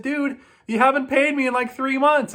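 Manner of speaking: high, loud exasperated dude; incredulous retort. Retold or imitated. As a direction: throw it back at him in disbelief. Retorting to nonpaying customer.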